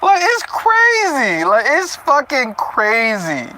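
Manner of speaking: slow, high words through laughter; laughing disbelief. Real, not acted. Reader laughing at the heroine's mood swings, slow and high.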